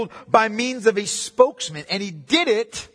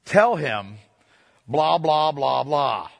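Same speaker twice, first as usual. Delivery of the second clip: blah-blah imitation of talking through a go-between; mock snubbing; 6 semitones lower, much slower than usual. Someone refusing to speak to another directly, mock snubbing. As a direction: act out a mild, pointed snub. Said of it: "only speak to you through somebody else".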